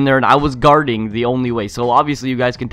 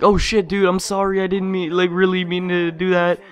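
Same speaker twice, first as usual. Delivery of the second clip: mock-innocent, higher apologetic voice; fake apology; 7 semitones higher than usual. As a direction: pretend to be sorry, not meaning it. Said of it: "Even though I did".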